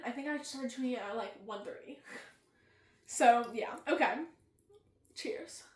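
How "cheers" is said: whispered cheers. Real, not acted. Toasting before tasting.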